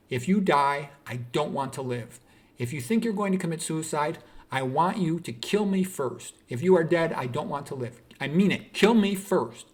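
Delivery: loud, emphatic bursts on each threat, imitating a mother; impassioned motherly plea. Mother's desperate plea retold in acting.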